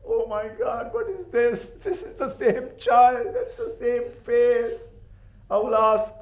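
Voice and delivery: anguished cry. Acted. The lady sees Hussein's head and cries out in anguish. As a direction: cry out as she recognises the face. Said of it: "she started crying".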